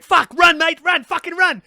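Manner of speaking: frantic high yell, running from a rhino; panicked shouting. Retold or imitated. Wildlife host fleeing a charging rhino, frantic shout. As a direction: yell at yourself to run.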